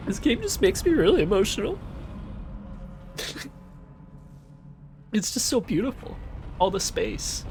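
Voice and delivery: a hammed-up choked-up voice; mock emotional. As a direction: pretend to be moved to tears, hammed-up. Joking that the game makes him emotional.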